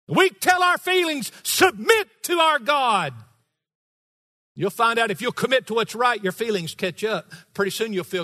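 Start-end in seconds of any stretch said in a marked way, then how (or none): 0.1-3.1 loud, high preaching shout; booming call to submit. Real, not acted. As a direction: command it from the pulpit. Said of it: "it takes a lot of volume".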